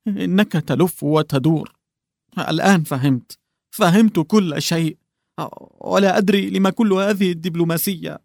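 put-on a distraught husband confronting the old clerk; trembling husband. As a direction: demand the truth as your hands shake. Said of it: "بيدين مرتعشتين".

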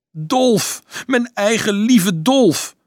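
high, loud, drawn-out endearment; emotional cry. A narrator's reading. Mother to her son Dolf, high emotional cry. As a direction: call out to a beloved child, slowly.

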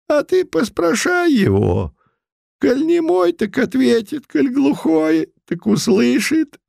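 put-on high mumbling old man voice; toothless mumble. Putilin keeps old man disguise.

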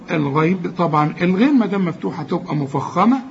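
ordinary delivery

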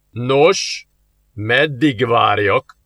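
slow, smug grinning drawl. A narrator's reading. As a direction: savour her panic. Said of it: "vigyorodott el a démon". (vigyorgó, lassú gúny)

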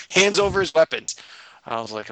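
usual speaking voice

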